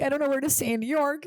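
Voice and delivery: high, panicky plea; freaking out. Retold or imitated. Calling her manager in a panic, voice pitched high. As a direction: squeak it out like you're freaking out. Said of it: "I'm like".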